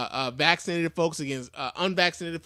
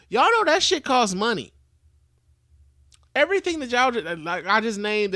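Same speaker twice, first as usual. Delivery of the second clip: loud emphatic rant; 5 semitones higher, 5 dB louder than usual. Real, not acted. Ranting about treatment costs.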